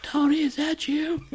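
very high, slow put-on voice; mock blind roommate. Playing the roommate who hears someone sneaking, high and slow. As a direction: call out timidly in a high voice.

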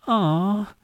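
high, cooing aw; hammed-up swoon; mock aww. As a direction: imitate a sappy moviegoer.

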